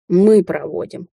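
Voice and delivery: slow, firm mild growl. A narrator's reading. Ruler insists on escorting witch too, growling. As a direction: draw it out with a restrained growl. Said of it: "рыкнул Рагнар".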